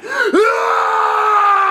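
super saiyan power-up yell. Pretend. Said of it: "you gotta get really mad".

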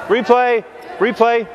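calling out. Real, not acted. Coach calling a replay.

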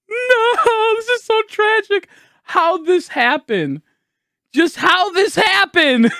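high, drawn-out despairing lament; anguished wail. Real, not acted. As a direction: wail in disbelief, voice pitched high. Despairing over the game mishap.

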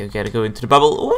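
normal talking voice

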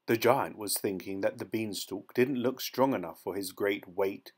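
ordinary delivery, nothing marked

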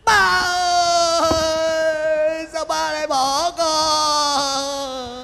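high, slow drawn-out mourning wail; hammed-up funeral lament; fake wailing. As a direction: wail for the dead father like a paid mourner. Hired mourner wails at funeral.

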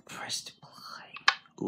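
unvoiced whisper over the dark title screen. Real, not acted. Whispering the map title to viewers in the dark, whisper. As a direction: hush it like the lights are out.

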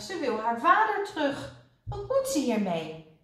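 irritated, high-pitched protest; cross. A narrator's reading. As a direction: argue it crossly on someone's behalf. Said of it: "riep Kwark nijdig".

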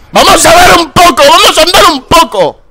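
very high, loud yelling; exasperated hollering; sarcastic shout. Real, not acted. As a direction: bellow it at the lazy campers. Shouting sarcastically at idle players.

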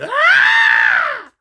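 long, very high mock scream; loud, piercing imitation shriek; hollered scream. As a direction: imitate her hollering at full pitch. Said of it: "she hollered".

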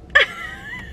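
high-pitched giggle; gleeful high laugh; laughter. Real, not acted. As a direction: laugh at the prank victim. Laughs at tricked partner.

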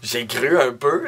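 ordinary talk, nothing marked

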